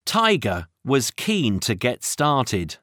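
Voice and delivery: usual speaking voice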